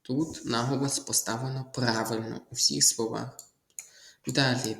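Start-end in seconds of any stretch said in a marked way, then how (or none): none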